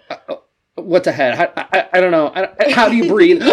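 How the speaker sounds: high, loud, laughing mock panic; hammed-up helplessness, breaking into laughter; mock confusion. Answering the chiropractor in a hammed-up, laughing panic. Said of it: "I'm like".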